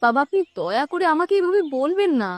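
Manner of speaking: soft, hurt pleading; tearful plea. Acted. Daughter-in-law begging father-in-law not to talk so, tearful plea. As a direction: plead quietly, close to tears. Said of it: "ন্যাকা কান্না কাঁদতে হবে না".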